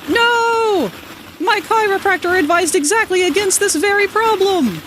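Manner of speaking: high, loud, hammed-up protest; mock outrage. Joking to chat about the chiropractor, high mock outrage. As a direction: cry out in theatrical alarm.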